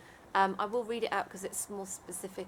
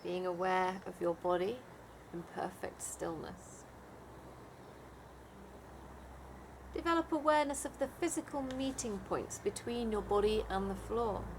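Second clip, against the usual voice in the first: guided meditation; 4 dB quieter than usual. Acted. Leads a Yoga Nidra meditation.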